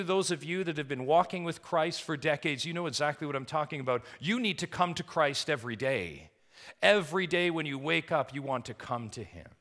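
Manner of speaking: high, loud, urgent sermon delivery; impassioned preaching. Real, not acted. Preacher urging longtime believers to come to Christ, impassioned. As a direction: press the appeal home to the congregation.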